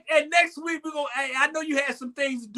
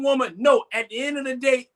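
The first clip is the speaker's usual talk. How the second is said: usual speaking voice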